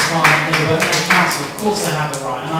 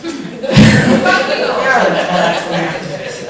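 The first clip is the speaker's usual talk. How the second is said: a loud, amused burst of laughter; 3 semitones higher, 12 dB louder than usual. Real, not acted. Councillor laughs at left-wing idea.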